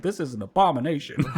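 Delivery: high-pitched mock-outraged grandma; hammed-up scandalized grandma, close to laughing; scowling grandma. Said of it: "my grandma just scowling".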